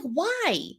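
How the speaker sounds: high, pleading why; mock pleading why-today; exasperated. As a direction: ask the sky why it had to be today. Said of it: "I'm just like".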